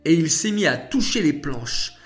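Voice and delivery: voix haute et animée de petite fille; fillette enthousiaste qui rassure. Acted. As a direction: raconter le miracle avec un entrain d'enfant. (enfant enthousiaste, voix aiguë)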